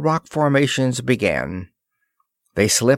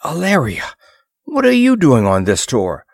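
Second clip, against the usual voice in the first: gasping, raised startled question; startled surprise; 5 semitones higher, 5 dB louder than usual. A narrator's reading. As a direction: caught off guard, voice jumps up. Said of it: "Ross caught his breath".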